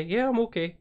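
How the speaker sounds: high, reassuring mimicked reply; imitated cyclist. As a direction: play the cyclist high-pitched. Voices the cyclist answering the driver.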